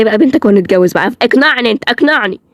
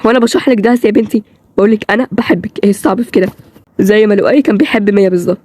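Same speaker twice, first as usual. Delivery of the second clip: normal talking voice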